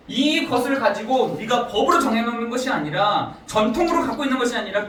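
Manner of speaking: ordinary delivery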